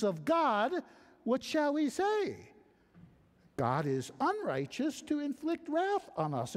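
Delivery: high, insistent protest of the objector; objecting reader. Acted. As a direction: argue your case like an indignant skeptic. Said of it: "the reader is in full objection".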